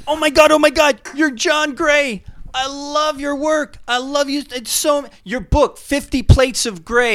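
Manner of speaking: high, excited fan voice; gushing fan. Pretend. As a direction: squeal it like a starstruck fan. Fan gushing at food psychic.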